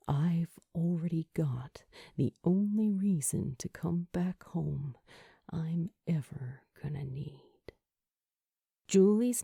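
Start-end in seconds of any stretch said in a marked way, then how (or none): 0.1-7.4 soft tender whisper; hushed and sincere, almost no voice; whispered confession. A narrator's reading.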